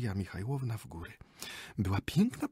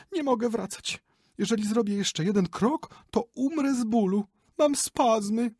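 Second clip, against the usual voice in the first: higher, louder fussy complaint; pleading lady; 13 semitones higher, 5 dB louder than usual. Acted. The lady refuses to ride back, higher and pleading. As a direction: whine that one more step will kill her.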